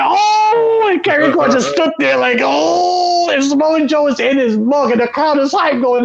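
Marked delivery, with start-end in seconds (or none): 0.0-0.9 high shocked gasp. Retold or imitated. Crowd reaction, retold. 2.4-3.3 high shocked gasp. Retold or imitated. Kross stunned, retold.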